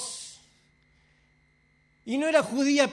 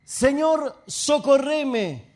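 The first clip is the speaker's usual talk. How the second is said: raised, drawn-out cry for help; pleading; 4 dB louder, much slower than usual. Retold or imitated. Woman prostrate before Jesus begs for help, raised plea. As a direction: plead it strongly, stretching the last word.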